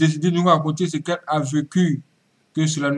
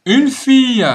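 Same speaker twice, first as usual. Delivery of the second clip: loud, high announcement; dramatic teaser; 9 semitones higher, 8 dB louder, slower than usual. Acted. Host dramatically announcing the story, loud. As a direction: punch out the teaser.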